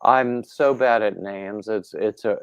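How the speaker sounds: usual speaking voice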